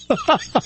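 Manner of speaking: high burst of laughter. Real, not acted. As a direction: laugh out at the memory. Laughs at own story.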